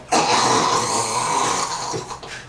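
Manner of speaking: long, noisy mock snore; imitated snore. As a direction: imitate a chainsaw snorer starting up. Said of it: "he would just go".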